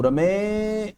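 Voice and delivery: long ए in रमे; stretched vowel. Shown as a demonstration. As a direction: pull out the ए in रमे like a call. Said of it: "ए को मैं पकड़कर खींच रहा हूँ".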